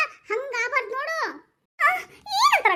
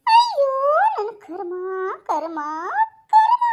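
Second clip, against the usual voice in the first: wailing despair; 8 dB louder, slower than usual. Acted. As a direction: throw up your hands and wail it. Comic wail of despair. (ಜೋರಾಗಿ ಗೋಳಾಡುತ್ತಾ)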